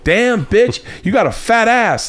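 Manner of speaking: hammed-up street catcaller yelling at a woman; mock crude catcall. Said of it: "a guy's not like".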